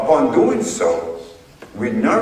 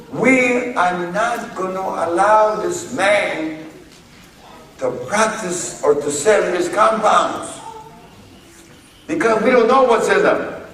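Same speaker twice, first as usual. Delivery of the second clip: mock FDA official; 7 semitones higher than usual. Voicing the FDA in court.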